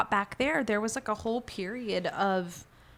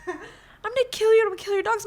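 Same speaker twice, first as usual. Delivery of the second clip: high, louder mock threat; angry woman; 13 semitones higher, 5 dB louder, much faster than usual. Driver threatening her and her dogs, high mock menace. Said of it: "she's like".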